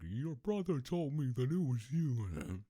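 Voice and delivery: high, loud mock scolding; dad yelling. Dad confronting the crying brother, acted angry yell. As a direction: imitate an angry father's yell. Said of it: "my dad was yelling at him".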